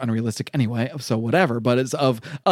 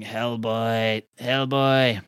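ghostly call; 3 semitones lower, much slower than usual. Acted. Voice calling from the grave.

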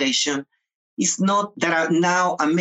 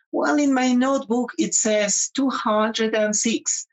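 a higher, lightly voiced younger self reporting; retold eager reply; 8 semitones higher than usual. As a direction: answer her brightly, like a proud student. Retelling own reply to teacher.

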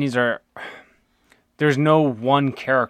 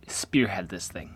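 whisper; 3 semitones lower, 7 dB quieter than usual. Real, not acted. Aside to co-host.

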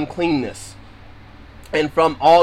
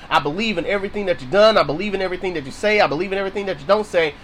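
fast, running declarations of faith; quick, insistent litany of belief; rapid-fire pledge; 3 dB quieter, much faster than usual. Acted. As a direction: rattle off the pledges in one eager rush. Acted prayer, rattling off pledges.

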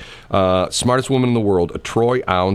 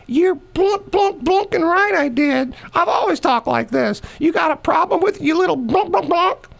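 put-on much higher, defiant old-lady voice; Granny Shelley. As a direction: challenge the kid in a high feisty granny voice. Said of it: "And Shelley would say".

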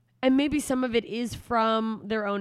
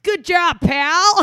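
higher, louder condescending praise; mock patronizing; 9 semitones higher, 15 dB louder, much slower than usual. Roommate not being talked down to, mock patronizing praise. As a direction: talk down to him like a child. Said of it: "I wasn't being like".

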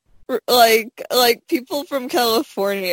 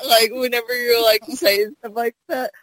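ordinary talk

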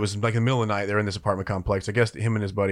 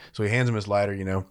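ordinary talk, nothing marked

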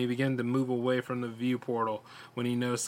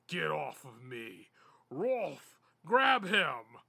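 monster's scream; 3 dB quieter, much slower than usual. A narrator's reading. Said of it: "it screamed at him".

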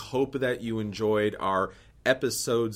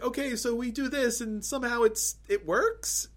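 excited; 13 semitones higher than usual. Retold or imitated. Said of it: "was like".

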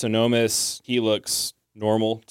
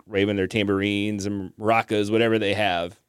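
ordinary talk, nothing marked

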